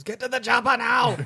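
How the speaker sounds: high, yelled action-hero impression; Schwarzenegger. Quoting a favorite movie part, mock action-hero yell. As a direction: yell it like a hammy action star. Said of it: "Schwarzenegger yells".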